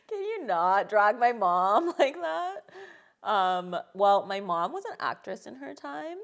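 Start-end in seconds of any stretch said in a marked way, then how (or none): 0.0-2.7 laughing. Real, not acted. Laughing protest at host's joke.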